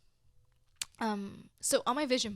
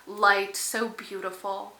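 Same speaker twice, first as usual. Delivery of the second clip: much louder, slow, overflowing; real overflowing joy, louder; full-hearted gush; 12 dB louder, slower than usual. Real, not acted. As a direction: pour out the gratitude. Gushing gratitude.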